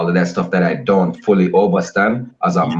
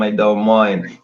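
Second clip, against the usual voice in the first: ordinary talk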